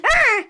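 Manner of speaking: high mock cat yowl; sudden hammed-up fright noise; startled yelp. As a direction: yelp like a cat jolted from sleep.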